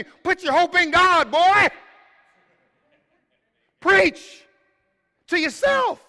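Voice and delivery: slow, soaring shout of exhortation; fiery preaching shout. Acted. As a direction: thunder it from the pulpit. Preacher shouting psalm to himself.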